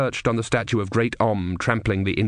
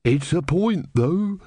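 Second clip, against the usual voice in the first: put-on mildly droning old bore, pitched up; boring elderly man; 7 semitones higher, slower than usual. A narrator's reading. Elderly man chipping in about the eagle, boring and unstoppable. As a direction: begin as if settling into a long lecture. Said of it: "in a kind of boring, unstoppable voice".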